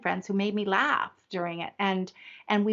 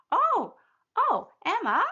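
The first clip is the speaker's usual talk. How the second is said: bright, much higher voice full of delight; delighted grandma; 8 semitones higher than usual. Retold or imitated. Grandma answering her granddaughter Emma with high delight. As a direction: answer the little one with sudden joy. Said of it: "I said".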